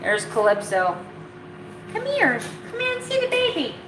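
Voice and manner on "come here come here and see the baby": high, fast coaxing call to a dog; high quick dog-calling voice; high pet-talk call. Real, not acted. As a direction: call the dog over in a high sing-song. Calling the other dog over.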